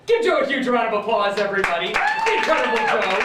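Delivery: loud, high hype shout; calling for applause. Real, not acted. Magician asks audience to applaud his helper, loud call. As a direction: whip up the crowd, loud and high.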